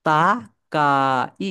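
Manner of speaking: "তাকাই" drawn out very slowly, syllable by syllable; "তাকাই" in slow, separated syllables; sounded out. Shown as a demonstration. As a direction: stretch each vowel of "তাকাই" for learners. Teacher sounds out new word.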